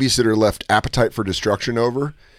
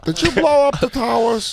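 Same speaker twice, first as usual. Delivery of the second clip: raised, drawn-out confrontation; slow, high dramatic accusation; 9 semitones higher than usual. Retold or imitated. Acting Pete confronting the sheik.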